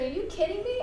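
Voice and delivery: disbelief. Retold or imitated. Retold disbelief.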